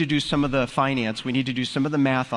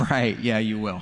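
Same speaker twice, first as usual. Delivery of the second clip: dry, lower mock disbelief; sarcastic; 6 semitones lower than usual. Pastor mocking the servant's promise to repay, dry sarcastic aside. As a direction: say it like you don't buy the promise for a second.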